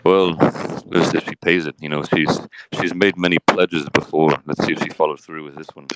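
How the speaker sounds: a low, laid-back Johnny Depp answering; Johnny Depp impression. Host interviews Johnny Depp on his case, lightly voiced low. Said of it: "stand up, Johnny Depp".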